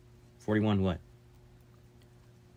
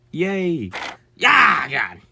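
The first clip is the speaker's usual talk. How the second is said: high excited whoop into a shout; excited cheer; 7 semitones higher, 9 dB louder, much slower than usual. Real, not acted. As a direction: cheer out loud with relief. Celebrates not dying.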